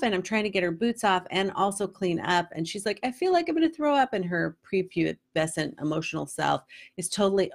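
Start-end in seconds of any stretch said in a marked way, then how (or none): 3.0-4.1 high, fast whiny overreaction; dramatic kid. Retold or imitated. Mother mocking her daughter's high whiny drama. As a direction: play the melodramatic tween.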